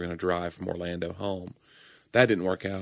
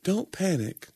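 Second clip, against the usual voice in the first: mock soothing; 11 semitones higher, slower than usual. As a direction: imitate an airline gently calming customers. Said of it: "trying to push it across".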